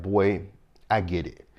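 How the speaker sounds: ordinary delivery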